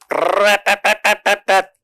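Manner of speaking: rapid rattling mock trumpet call, high-pitched; imitated trumpet blast. Angels blowing trumpets on God's command, mimicked fanfare. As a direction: mimic trumpets sounding with a held stutter. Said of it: "Tiup, tiup".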